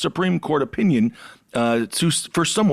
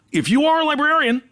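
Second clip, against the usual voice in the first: shouted warning; 10 semitones higher than usual. Acted. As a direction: yell it like a public warning.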